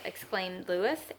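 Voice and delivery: ordinary delivery, nothing marked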